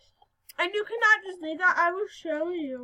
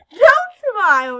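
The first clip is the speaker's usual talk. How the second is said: loud joking repeat; 5 semitones higher, 14 dB louder than usual. Pretend.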